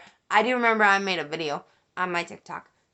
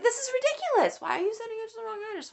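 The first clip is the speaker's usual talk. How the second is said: high-pitched, rapid retold protest; exasperated complaint; 12 semitones higher, 4 dB quieter, much faster than usual. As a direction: reenact an indignant complaint, pitched high and speeding up.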